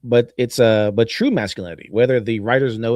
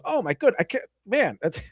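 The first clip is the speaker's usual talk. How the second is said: much higher, breaking into giggles; mock shocked Baby Doll; 7 semitones higher, 5 dB quieter than usual. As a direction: squeal it like you can't hold the laugh in. Imagined Baby Doll reacting to fart.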